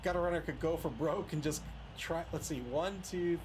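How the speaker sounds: usual speaking voice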